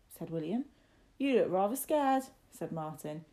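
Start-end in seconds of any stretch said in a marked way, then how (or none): 1.2-2.2 loud, raised-pitch needling; teasing. Acted. Lad needles young master about his fear, teasing. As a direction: tease him loudly and pointedly.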